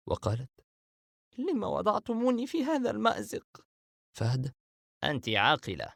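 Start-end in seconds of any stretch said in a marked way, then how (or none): 1.4-3.7 anxious. Acted. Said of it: "علامات التوتر والقلق".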